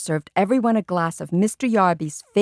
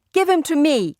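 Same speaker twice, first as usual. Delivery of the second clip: put-on angry boy; 8 semitones higher, 5 dB louder than usual. A narrator's reading. Angry boy takes turtle.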